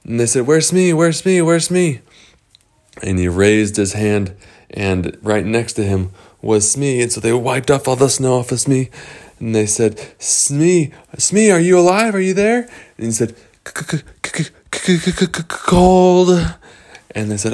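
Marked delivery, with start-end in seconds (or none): 0.5-2.0 high, rapid repeated question; frantic. Acted. Rescuers asking Hook where Smee is, frantic. 10.2-12.7 high, raised calling to rouse someone; urgent concern. Acted. Rescuers wiping snow off Smee, urgent. 13.7-16.6 chattering high stutter, then a long loud word; shivering stutter. Acted. Smee just dug from the snow, acting shivering.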